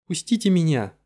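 loud angry plea; outburst. Acted. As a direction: flare up and demand release. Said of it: "вспылила Фелиция".

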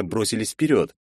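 ordinary delivery

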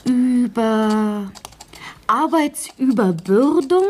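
measured, word-by-word dictating pace; slow dictation. Acted. Dictating the meeting report to the writer, slowly. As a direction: spell out each phrase for the scribe.

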